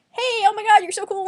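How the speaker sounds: high-pitched excited burst, loud at first; hammed-up gushing greeting. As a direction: squeal it like an overeager new friend. Acts out gushing talkative greeting.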